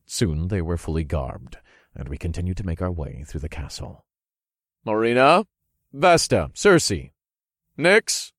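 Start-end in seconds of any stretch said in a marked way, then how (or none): 4.9-8.3 calling out. Acted. Calling names through halls.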